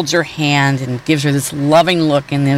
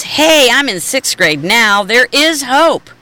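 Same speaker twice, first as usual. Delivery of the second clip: put-on high, rising hopeful boy voice; high, drawn-out happy There is hope; hopeful kid; 9 semitones higher than usual. Short boy hopeful about growing.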